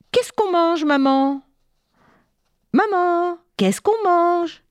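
put-on high pleading child's voice, dragged out; a little boy nagging mum about lunch; insistent child. Léo insiste pour manger. (petit garçon qui insiste, voix aiguë et traînante)

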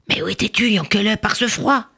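usual speaking voice

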